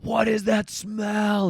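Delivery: high, slow, loud yell; screaming. Retold or imitated. Roommate screaming from his room about the smell. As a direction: bellow it down the hall in disgust. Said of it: "I hear Dave go scream".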